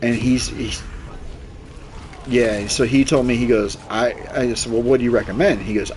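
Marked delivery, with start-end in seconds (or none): none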